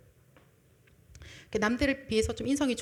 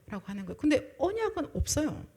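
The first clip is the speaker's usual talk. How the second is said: ordinary talk, nothing marked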